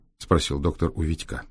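usual speaking voice

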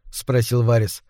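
ordinary delivery, nothing marked

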